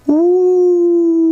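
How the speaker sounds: high loud ooh; wonder. Real, not acted. Marvelling at the balloon for the child. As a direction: gasp in delight.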